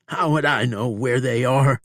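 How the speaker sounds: high, loud pleading protest; pleading wounded outlaw, high and loud; desperate outlaw. A narrator's reading. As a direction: beg him to believe you. Bob pleading ignorance.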